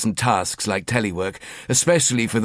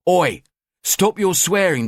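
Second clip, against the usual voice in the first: loud, higher Oi scolding; sharp telling-off; 8 semitones higher, 3 dB louder, slower than usual. Acted. Wife scolding husband for swearing, loud and sharp. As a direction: snap at him to stop swearing.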